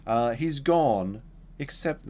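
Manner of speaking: strict religious mum. Retold or imitated. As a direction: imitate a stern mother laying down the rule.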